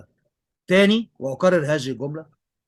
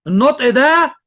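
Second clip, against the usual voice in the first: high and loud, pointing out the pronunciation; النطق ده thrown out loud and high in praise; proud teacher exclaiming; excited emphasis; 10 semitones higher, 8 dB louder, slower than usual. Real, not acted. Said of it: "بص النطق عامل إزاي".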